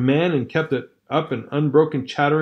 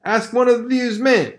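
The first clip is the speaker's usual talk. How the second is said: higher, heated brother's voice; Hal scolding; 9 semitones higher than usual. Acted. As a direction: argue hotly with his sister. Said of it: "her brother sneered".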